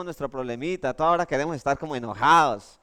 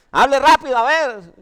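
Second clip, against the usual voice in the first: higher and louder, an impatient bossy command; impatient shout; 7 semitones higher, 8 dB louder than usual. Retold or imitated. Rude Santander waitress telling the customer to hurry, loud and impatient. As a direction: play the pushy waitress telling him to hurry.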